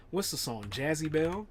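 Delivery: usual speaking voice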